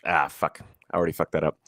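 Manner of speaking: ordinary talk, nothing marked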